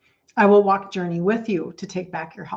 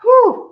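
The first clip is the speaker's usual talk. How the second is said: sharp high breath out to steady herself; whew, regaining composure; shaky exhale; 8 dB louder than usual. Real, not acted. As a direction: blow the tears away. Shaking off tears.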